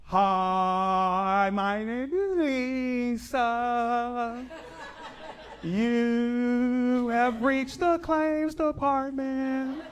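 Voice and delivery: very slow, high, drawn-out claims agent; slow slurred voice. Retold or imitated. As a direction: stretch every word, very slow. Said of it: "Why are you talking like that?".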